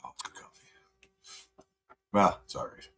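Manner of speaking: soft whisper, savoring a sip; whispered aside. Real, not acted. Tasting his coffee after the call, in a contented whisper. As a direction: breathe it out to yourself.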